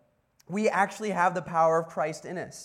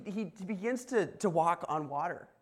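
ordinary delivery

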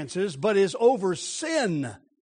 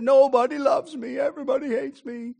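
lightly voiced sulky little boy, higher pitch; pouting little boy; 4 semitones higher than usual. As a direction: imitate a child's pout, a bit whiny. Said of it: "and pouted".

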